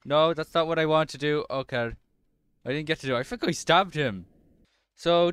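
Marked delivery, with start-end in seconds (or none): none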